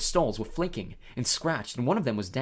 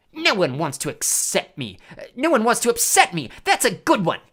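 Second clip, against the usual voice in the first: loud, wailing disbelief; howled; 7 semitones higher, 7 dB louder than usual. A narrator's reading. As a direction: throw the words back as an aggrieved howl. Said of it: "howled Myrtle".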